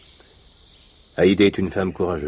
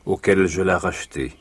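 usual speaking voice

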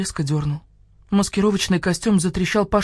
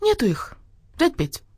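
high little pup voice; thin puppy yap; 9 semitones higher than usual. A narrator's reading. Pup answers the rooster from the kennel, thin yap. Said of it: "тоненько тявкнул кто-то из будки".

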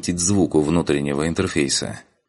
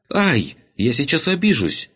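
high yelp, then a pout; pouting girl; 9 semitones higher than usual. Acted. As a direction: protest like a wounded, offended girl. Girl yelps, threatens to take offence. (обиженное девичье ойканье)

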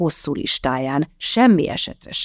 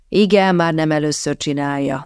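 usual speaking voice

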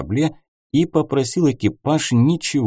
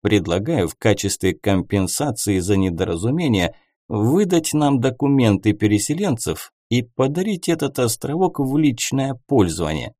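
ordinary delivery

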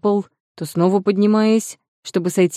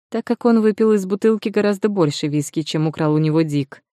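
ordinary delivery, nothing marked

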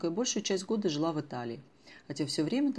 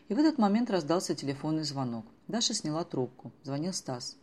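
ordinary delivery

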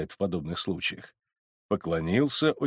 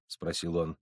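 ordinary delivery

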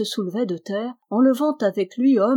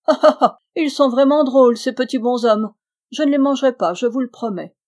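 much louder, higher hearty laughter; booming laugh; 5 semitones higher, 8 dB louder, faster than usual. Acted. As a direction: laugh out big and amused. Giant laughing at small cousins.

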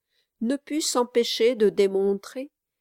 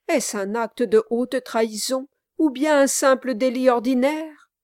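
raised, loud, mocking questions; smiling mockery; 4 semitones higher, 6 dB louder than usual. A narrator's reading. Brass ridicules Kit's charge before the gentlemen, smiling mockery. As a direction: address the room with a smile, playing up the drama. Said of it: "dit Brass en souriant".

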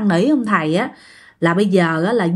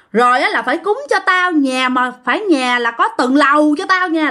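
spiteful spirit's demands; 6 semitones higher than usual. Retold or imitated. The spirit haggles with the priest over offerings, high and snide. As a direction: drag out each demand like a spoiled, hateful ghost. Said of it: "ra cái vẻ thấy ghét lắm".

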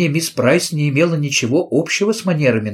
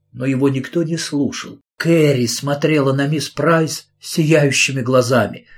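plain reading voice

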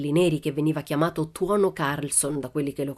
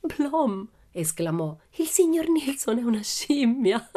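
laughing exclamation; 7 semitones higher, slower than usual. A narrator's reading. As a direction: burst out at the monkey. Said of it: "non riuscì a trattenere le risate". (esclamazione divertita e acuta)

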